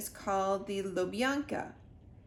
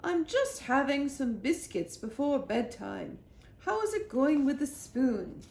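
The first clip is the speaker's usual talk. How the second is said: a queen chatting at bedtime; 5 semitones higher, 4 dB louder than usual. A narrator's reading. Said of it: "said the Queen".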